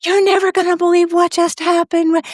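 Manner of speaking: high-pitched, eager news-breaking; excited relative. Retold or imitated. Relatives picking her up in the car, excited. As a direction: blurt it out high, bursting with news.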